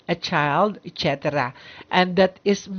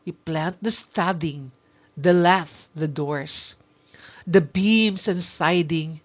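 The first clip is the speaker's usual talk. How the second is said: poem recitation. Shown as a demonstration. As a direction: recite it line by line, with pauses and feeling. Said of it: "Let me show you the example".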